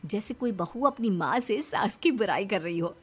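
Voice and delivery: usual speaking voice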